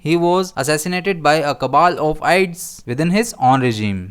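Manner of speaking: each word of the sentence read very slowly and clearly; teacher dictating an example sentence. Shown as a demonstration. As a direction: read it out slowly so learners follow. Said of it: "हम इसे कैसे use करेंगे".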